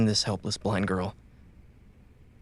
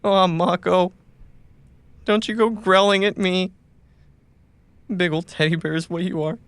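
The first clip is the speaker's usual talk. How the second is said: fond teasing; 8 semitones higher, 3 dB louder than usual. Acted. Soothing a growling companion.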